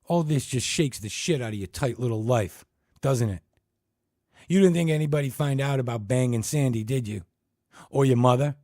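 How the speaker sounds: loud high tirade; furious attack. Acted. Dick Keene tearing into Ted, loud and high. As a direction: tear into him.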